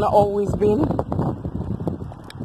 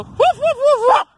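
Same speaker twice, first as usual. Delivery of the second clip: high, playful mock barking; high yappy woofs in imitation; imitated dog bark; 6 dB louder than usual. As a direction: bark like an excited little dog. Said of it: "And she go".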